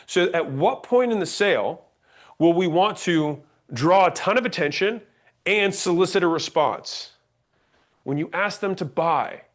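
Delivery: ordinary talk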